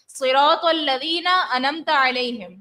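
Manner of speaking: صراط الذین انمتا علیہم read slowly with the ain skipped; slow demo of the mistake, انمتا without ain; wrong recitation, ain dropped; wrong pronunciation. Shown as a demonstration. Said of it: "اس کا معنی کیا بن گیا".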